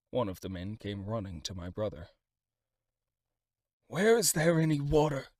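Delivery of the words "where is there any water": 1 urgent plea. A narrator's reading. Man seeks water for dying lord.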